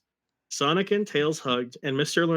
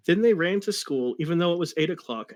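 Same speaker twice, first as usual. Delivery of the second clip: ordinary talk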